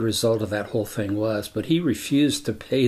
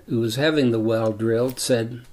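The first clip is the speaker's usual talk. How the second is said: normal talking voice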